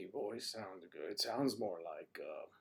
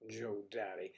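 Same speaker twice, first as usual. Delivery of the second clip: normal talking voice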